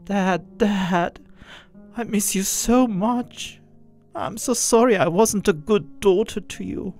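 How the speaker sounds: pouring out regret to a lost father; tearful plea. Acted. Daughter tearfully apologising to her dead father. Narrator in tears to dad.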